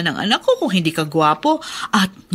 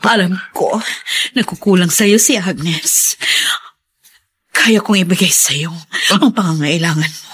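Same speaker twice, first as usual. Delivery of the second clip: seductive whisper. Acted. Mother-in-law seducing him in bathroom.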